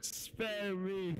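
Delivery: high, drawn-out plea for mercy; hammed-up begging; mock pleading. Repeating the joke plea.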